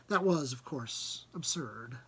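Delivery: usual speaking voice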